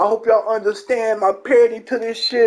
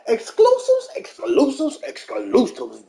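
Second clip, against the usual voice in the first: hammed-up catchphrase; 5 semitones higher than usual. Streamer signing off with his catchphrase, put-on voices.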